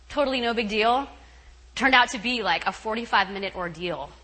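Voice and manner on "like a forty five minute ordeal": ordinary talk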